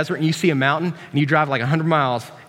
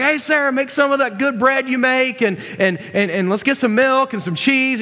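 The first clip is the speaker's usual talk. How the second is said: higher-pitched, bustling request; frantic host; 7 semitones higher than usual. Retold or imitated. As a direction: call out orders to your wife, all flustered. Abraham frantically orders feast preparations from Sarah.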